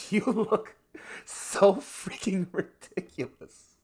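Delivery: high, loud, slow outburst breaking with laughter; tearful laughter. Acted. Daughter sees her lampshade-faced father, breaks into tearful laughter. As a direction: break into riotous laughter through tears. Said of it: "then she breaks into laughter".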